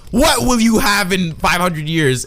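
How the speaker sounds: slow, high, declaimed confrontation; hammed-up interrogation; mock furious demand. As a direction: thunder the question slowly in mock rage. Acting cartoon father's furious confrontation.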